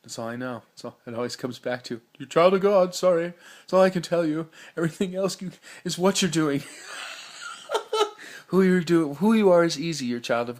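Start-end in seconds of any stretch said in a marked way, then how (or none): 2.2-6.6 high, loud and fervent, almost preaching; excited shout. Retold or imitated. Shouting his own answer back at himself, loud and high. 6.7-8.4 airy, very high giggle with no voice; high breathy laugh. Real, not acted. Laughing at his own outburst, high and airless.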